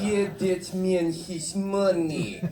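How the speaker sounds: high, loud, very slow mock-Russian accent; John Malkovich Russian accent. Actor quoting Rounders to the audience in a hammy Russian accent. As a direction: ham up a thick Russian accent, stretching every word. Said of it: "with, uh, John Malkovich".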